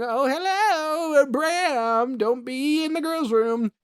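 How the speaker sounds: put-on high, slow sing-song old nun calling; lightly voiced spooky nun drifting down the hall; creepy old nun. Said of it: "walking down the hall going".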